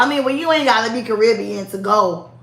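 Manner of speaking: usual speaking voice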